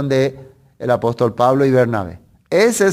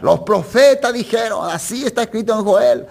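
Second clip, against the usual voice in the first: higher-pitched, proclaiming preacher voice; preaching apostle; 6 semitones higher than usual. Acted. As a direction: proclaim it to the crowd. Acts out Peter preaching at Pentecost. (predicador proclamando, con tono agudo)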